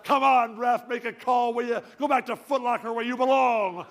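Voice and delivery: loud, high heckling from the bench; yelling at the refs. Retold or imitated. Old player roaring at the refs. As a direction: bark at the referee like a courtside fan. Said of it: "yelling at the refs".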